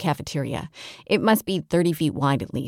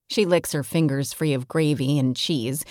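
usual speaking voice

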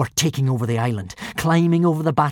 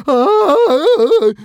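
high scream of fear; terrified shriek; 13 semitones higher, 9 dB louder than usual. A narrator's reading. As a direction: shriek in terror. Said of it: "shrieked Fishlegs".

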